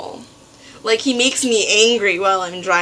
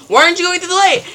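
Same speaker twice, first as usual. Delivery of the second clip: loud, high, exasperated question; instructor scolding; 9 semitones higher, 6 dB louder, much faster than usual. Retold or imitated. Instructor demanding why she stopped, loud and exasperated. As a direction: scold the student as a cranky instructor. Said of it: "He's like".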